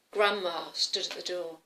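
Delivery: plain reading voice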